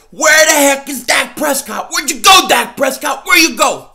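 loud calling out for Dak; mock searching shout. Host calling out for Dak, loud mock yells. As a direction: yell his name like he's lost.

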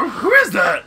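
loud put-on cartoon voice; put-on character voice. Player voicing a game character, put-on voice. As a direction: exclaim it in a cartoon voice. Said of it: "you have to pick another voice".

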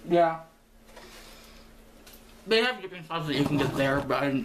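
words muffled by food; talking while chewing. Real, not acted. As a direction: mouth full of food. Seen 3: bites food at -2.0, puffed cheeks, chewing, food visible while talking.

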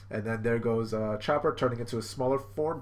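normal talking voice

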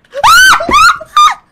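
shrieking startled laugh; high scream into laughter; jump scare laugh. Real, not acted. Scared by another player.

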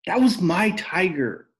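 high loud furious scream. A narrator's reading. Rob screaming at his father over the tiger. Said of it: "Rob screamed".

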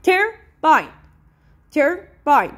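turbine in two separate beats, tur, bine; split into syllables. Shown as a demonstration. Teacher sounding out turbine for the class, syllable by syllable. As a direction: pause between tur and bine. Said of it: "Two syllable".

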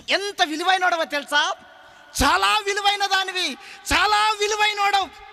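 preacher crying out how precious they are; preaching at full force. Acted. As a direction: lift them up, shout their worth. Preacher rising to a shout.

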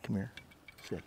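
ordinary talk, nothing marked

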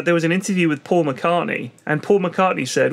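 usual speaking voice

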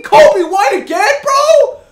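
high, very loud disbelieving yell; outraged shout. Real, not acted. Gamer pulling the same card again, outraged shout.

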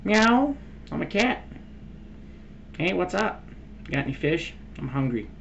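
high playful cat voice. Pretend. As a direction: mew it like a cartoon cat. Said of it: "I'm gonna become a cat".

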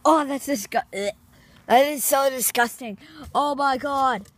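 loud, high disgusted groaning; disgusted by smell. Real, not acted. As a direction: react to a horrible stink. Smelling rotten milk.

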